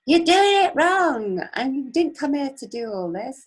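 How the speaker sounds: urgent high yell of protest; heart screaming out. Retold or imitated. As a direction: belt it out like an inner cry of alarm. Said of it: "is screaming out".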